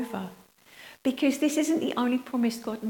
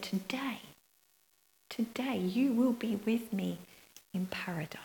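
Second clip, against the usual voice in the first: hushed; 6 dB quieter than usual. Acted. Quoting Jesus's promise, hushed.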